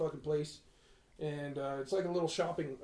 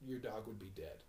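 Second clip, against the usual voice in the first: deadpan lady; 9 semitones lower, 15 dB quieter than usual. Retold or imitated. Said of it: "she's like".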